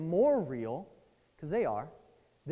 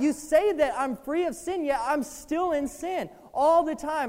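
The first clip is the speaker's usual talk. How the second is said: retold plea to God; 5 semitones higher, 6 dB louder than usual. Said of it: "cry out to God saying".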